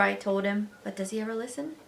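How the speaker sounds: plain reading voice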